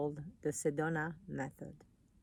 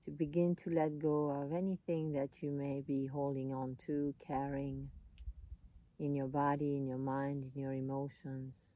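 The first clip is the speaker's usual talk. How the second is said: calm, unhurried voice with long pauses; soothing meditation guide, leaving space between phrases; guided meditation; 5 dB quieter than usual. Real, not acted.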